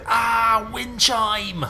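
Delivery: high, loud drawn-out cry; mock scream. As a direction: play-act a jump-scare yell. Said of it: "turn around and say".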